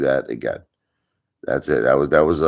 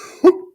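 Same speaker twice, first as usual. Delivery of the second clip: sharp gasp; 7 dB louder than usual. Pretend. Acting out a scare.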